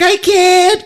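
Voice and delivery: very high, strained cry; hammed-up helpless wail; mock panicked. Duck with gun fails to shoot, imitated high panicked cry.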